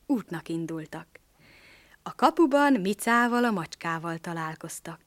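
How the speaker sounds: ordinary delivery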